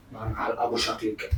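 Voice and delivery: low, very soft aside; secretive low murmur; hushed aside. Real, not acted. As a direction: keep it under your breath, low. Muttered aside during prank.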